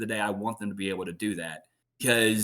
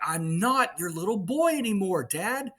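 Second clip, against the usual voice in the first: much higher, indignant cartoon teen; teen Max; 12 semitones higher than usual. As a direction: push back like a grown-up son. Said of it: "And Max is like".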